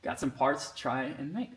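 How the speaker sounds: ordinary talk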